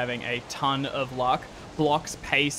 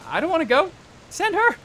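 very high, loud protest; reluctant Higgins; 5 dB louder than usual. Acted. Passenger told to come outside, panicky refusal. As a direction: squeal a refusal, pitched way up. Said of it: "And Higgins goes".

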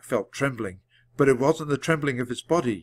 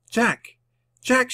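calling out; 12 semitones higher than usual. A narrator's reading. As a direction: call as if rousing someone. Annie calls to rouse Jack.

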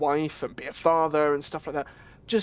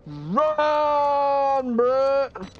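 panicked yell; 11 semitones higher, 4 dB louder than usual. Real, not acted. Player yelling at the game character to flee, loud panicked shout. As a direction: shout it high and loud, run for your life.

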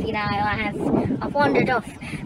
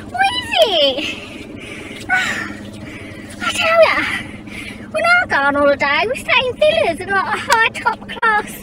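higher, louder snooty Mad Mel complaining about the holiday; 10 semitones higher, 5 dB louder than usual. Acted. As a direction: play the stuck-up runaway sneering at the cheap holiday. Mad Mel character monologue.

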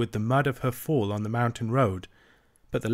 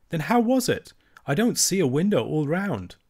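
puzzled wonder; 6 semitones higher than usual. A narrator's reading. Irene wonders to grandmother about the lamp, high puzzled question. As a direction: ask in bright astonishment.

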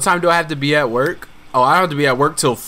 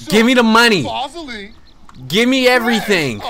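hammed-up holdup demand; mock robber; 7 semitones higher than usual. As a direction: play the street thug, half joking. Shakedown in game.

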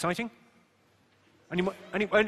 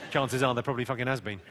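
ordinary delivery

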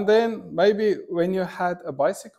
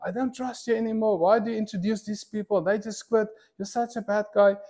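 higher and fast, mock angry boss; a hint of a scolding boss, higher and quicker; quoting angry boss; 7 semitones higher, 3 dB quieter, faster than usual. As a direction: rattle off the boss's accusation.

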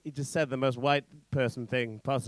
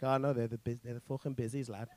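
put-on Liverpool accent; 5 semitones lower, 9 dB quieter, faster than usual. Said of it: "you guys call them busies in Liverpool".

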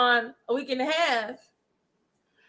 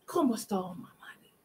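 softer, in a mocking whisper; whispered echo; 3 semitones lower, 8 dB quieter, faster than usual. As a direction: repeat it under your breath. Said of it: "She's telling everybody".

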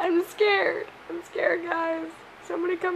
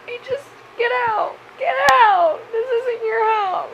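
tearful shout; 4 semitones higher, 7 dB louder than usual. Real, not acted. Yelling at moth to leave.

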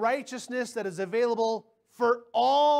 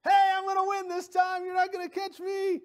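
high, loud sing-out over the shoulder; taunting yell; 10 semitones higher, 4 dB louder than usual. Retold or imitated. Sister yelling back at her brother mid-race, taunting yell. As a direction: yell it like a gloating kid sister. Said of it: "and yell at me".

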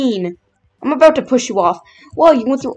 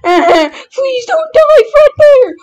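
desperate pleading scream; 14 semitones higher, 8 dB louder, much slower than usual. Acted. Desperate plea, character dying.

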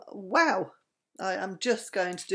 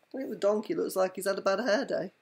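usual speaking voice

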